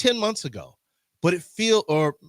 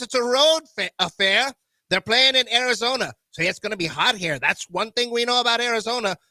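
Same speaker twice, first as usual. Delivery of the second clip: 1930s announcer voice. Acted. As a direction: call the lineup like a 1930s broadcast. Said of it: "in my nineteen thirties voice".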